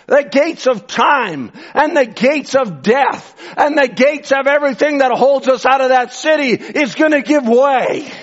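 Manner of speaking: real fervour, pitched high; excited preaching. Real, not acted. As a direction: preach it carried away with excitement. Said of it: "Sorry, I'm excited".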